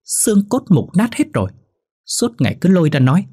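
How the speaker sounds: a son shouting down his mother over selling the land; angry yelling. A narrator's reading. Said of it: "Phát gào lên".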